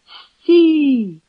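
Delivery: high, long-held call; shouting halt. A narrator's reading. Maksym cries out at the fleeing stranger, shouting halt. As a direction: cry out to halt someone fleeing. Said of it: "ще раз крикнув Максим".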